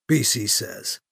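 usual speaking voice

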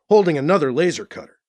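ordinary delivery, nothing marked

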